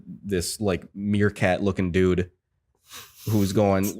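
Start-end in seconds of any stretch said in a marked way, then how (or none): none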